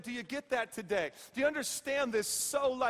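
normal talking voice

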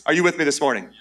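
ordinary delivery

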